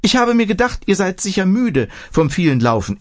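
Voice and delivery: a hint of a kindly granny inviting guests in; old village woman. A narrator's reading. Old woman offers tea. (leicht gespielte alte Frau, höher und einladend)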